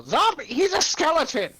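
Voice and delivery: indignant high, loud shout; loud, high-pitched offended outburst; Bone Malone. Acted. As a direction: yell an outraged correction from afar. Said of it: "you hear Bone Malone just go".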